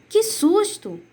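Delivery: startled exclamation. Shown as a demonstration. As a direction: say it like something just jumped out. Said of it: "com a carinha de susto".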